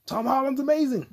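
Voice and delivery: mock high voice. High mocking aside on Holland.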